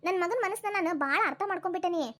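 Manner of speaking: ordinary talk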